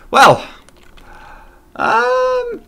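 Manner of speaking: sudden high squeak; squeaky um. Real, not acted. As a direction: jump way up in pitch. Comic squeak.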